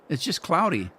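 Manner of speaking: ordinary delivery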